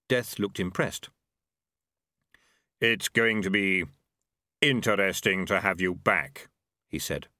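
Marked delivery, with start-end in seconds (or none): 2.8-6.5 lightly voiced grim reaper, unhurried. A narrator's reading. Death voiced in story.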